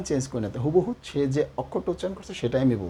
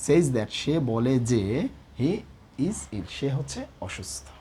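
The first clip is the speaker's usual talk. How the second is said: slow dictation; much slower than usual. Shown as a demonstration. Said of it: "তাহলে এখানে শিখলাম".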